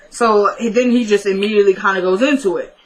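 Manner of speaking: ordinary talk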